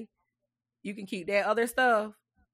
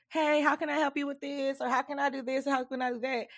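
higher-pitched mock offer of help; imitating eager helpers; 6 semitones higher than usual. Fed-up host mimicking people who reached out to help, mock-eager. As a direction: put on a high voice for the would-be helpers.

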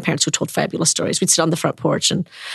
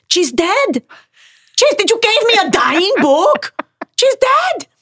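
put-on high, loud, anguished child's wail; upset child; 13 semitones higher, 4 dB louder, slower than usual. A child wailing to the librarian over a dead spider. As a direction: cry it out like a heartbroken kid. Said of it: "I was sobbing and I was furious".

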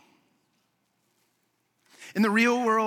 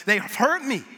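a bit louder and higher, hurt and upset; retold upset outburst; 4 semitones higher, 3 dB louder than usual. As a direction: replay the outburst from the phone call. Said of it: "I was growing more upset".

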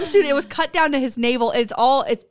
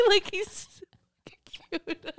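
soft whispered he's so cute; breathless gush; 6 semitones higher, 10 dB quieter than usual. Retold or imitated. Mom gushing over the [name] impersonator, whispered. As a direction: whisper it like a smitten fan.

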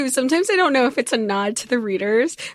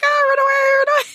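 high-pitched mock panic; hammed-up squeal of someone fleeing; mock panicked retreat; 4 dB louder, faster than usual. Retelling the heroine losing control of the monster, mock panicked.

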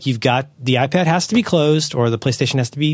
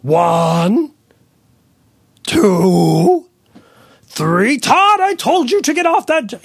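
high, loud mock parent calling Todd; hammed-up scolding parent, pitched way up; exasperated parent; 10 semitones higher, 4 dB louder, much slower than usual. Parent scolding Todd off the jungle gym, high mock yell. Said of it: "you hear in the parent's voice".

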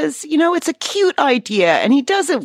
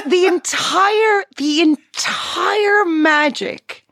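slow drawl; 9 semitones higher, much slower than usual. Acted. Mocking the boring show.

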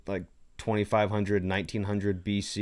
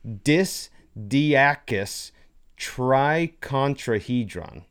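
very slow, stretched syllables; careful sounding-out; 3 semitones higher, much slower than usual. Real, not acted. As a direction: work through a hard name piece by piece. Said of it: "really hard for me to think and say".

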